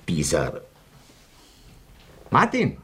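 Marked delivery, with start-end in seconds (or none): 2.3-2.8 calling out. Acted. Calling out across the room.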